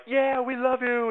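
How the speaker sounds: high, slow hammed-up crowd cheer; mock cheering soldiers. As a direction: play the soldiers gushing back at him.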